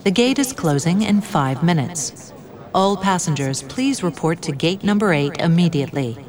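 airport announcement. Acted. Airport final call announcement.

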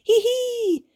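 very high squealed hee-hee; tiny high-pitched titter; little girl's giggle. A narrator's reading. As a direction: giggle like a delighted small child, way up high. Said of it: "nauroi pikku Iida".

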